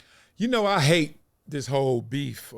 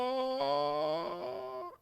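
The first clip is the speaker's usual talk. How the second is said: long held "oh"; rap warm-up note; 3 semitones higher, 4 dB quieter than usual. Pretend. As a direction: stretch out one sustained vocal note. Warming up to rap.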